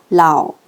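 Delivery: 라오 dropped low; falling third tone. Shown as a demonstration. Teacher demonstrates third tone, 라오 going down low. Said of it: "삼성으로 내려갑니다".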